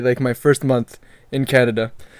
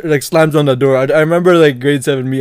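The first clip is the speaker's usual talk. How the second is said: ordinary talk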